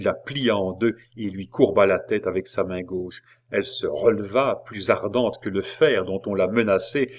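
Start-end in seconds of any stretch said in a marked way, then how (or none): none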